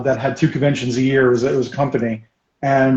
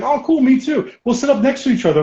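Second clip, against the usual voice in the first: much higher, eager imitation; acted friendly enthusiasm; 12 semitones higher than usual. Stranger greeting a fellow gamer at a convention, acted eager. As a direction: mimic a super friendly newcomer.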